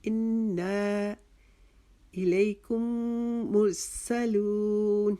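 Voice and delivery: slow, drawn-out tajweed recitation; Quran recitation. Shown as a demonstration. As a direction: recite each word stretched, in a chanting voice. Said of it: "الی کو ہم نے ایک الف کے جتنا لمبا کرنا ہے". (قرآنی تلاوت کا آہستہ، کھینچا ہوا لہجہ)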